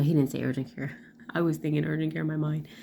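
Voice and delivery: normal talking voice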